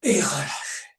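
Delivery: breathy whisper on the release; whispering to let the class relax. Acted.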